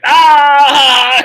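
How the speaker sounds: big high roaring shout; mock Scottish roar. The Scots roaring back at the American. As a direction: roar it like a rowdy Scot. Scots cheering at July 4 joke.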